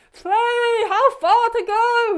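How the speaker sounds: high, loud, slow yell into a headwind; strained shout against the gale; shouting over wind. Retold or imitated. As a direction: call to her over roaring wind. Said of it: "I'm going".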